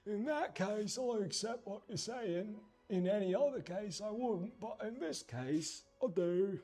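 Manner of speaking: high put-on British accent. Painter accepting what the mate is saying, high mock British accent. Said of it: "You might become British".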